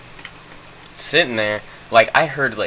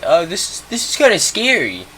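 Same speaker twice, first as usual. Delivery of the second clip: high, nervous imitation of a scared friend; mock scared girl voice; 7 semitones higher than usual. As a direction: squeak it out like a timid friend. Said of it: "Ivy was like".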